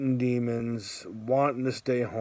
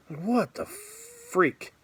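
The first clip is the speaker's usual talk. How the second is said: mock disbelief; 7 semitones higher, much slower than usual. Calves hurting mid run.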